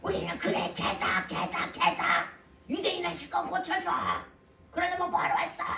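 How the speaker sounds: mock a pushy complainer in a strained put-on voice; demanding tone. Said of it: "고쳐달라는 그 말투가". (따지듯 요구하는 말투 흉내)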